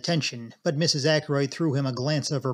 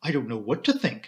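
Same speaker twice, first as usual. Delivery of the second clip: ordinary delivery, nothing marked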